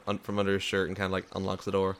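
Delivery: ordinary talk, nothing marked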